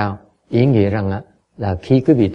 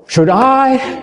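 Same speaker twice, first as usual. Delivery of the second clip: should I, much higher, wondering to himself; mock inner doubt; 13 semitones higher, 3 dB louder than usual. Teacher acting a student's inner doubt, much higher. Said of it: "You say".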